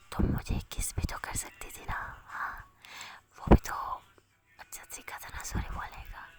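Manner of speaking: unvoiced, intimate whisper; whispering in the ear. A narrator's reading. Ana drawing Anu close to hint at a kiss, whispering. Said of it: "उसके कानों में बोलती है".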